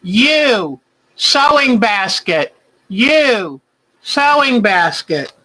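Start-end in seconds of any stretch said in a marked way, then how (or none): none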